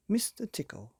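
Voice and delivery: ordinary delivery, nothing marked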